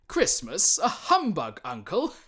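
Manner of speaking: put-on bright, loud young nephew, merry and teasing; a loud, cheery young man's voice; cheerful nephew. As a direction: laugh it off to the grumpy uncle.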